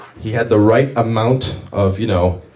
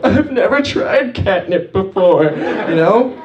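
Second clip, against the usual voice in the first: much higher, put-on voice; high-pitched mock hesitance; 10 semitones higher, slower than usual. Comic answers a talking cat, high put-on voice.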